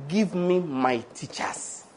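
slow, hushed plea to God; very slow, softened petition; prayer. Shown as a demonstration. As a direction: pray it softly, stretching each word. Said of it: "you ask God".

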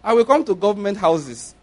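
ordinary talk

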